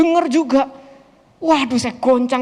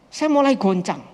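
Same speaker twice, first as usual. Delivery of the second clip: ordinary delivery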